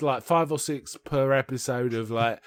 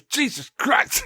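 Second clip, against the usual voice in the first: higher, slow horrified gasp of a curse; see the guillotine and blurt it out; shocked outburst; 10 semitones higher, slower than usual. Retold or imitated. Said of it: "He goes".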